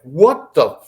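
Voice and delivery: high, loud retold outburst; angry dad. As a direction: imitate an angry father hollering through the house. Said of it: "And he's angry".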